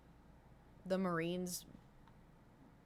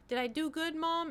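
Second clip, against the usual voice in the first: cute puppy voice; 9 semitones higher than usual. Retold or imitated. Puppy voiced in TikTok trend.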